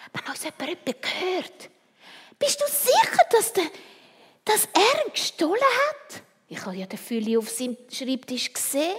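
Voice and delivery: put-on high, loud, excited kid voice; excited child. Freddy excitedly asks Christoph about the stolen pen, high and loud. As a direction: blurt it out, eager and high.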